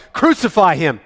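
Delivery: loud, high cry of the mob; crowd shout. Acted. Chief priests shouting at Pilate, loud demand. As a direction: roar it out for blood. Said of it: "they gave a great shout".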